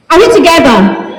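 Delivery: ordinary talk, nothing marked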